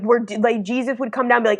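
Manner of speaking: ordinary delivery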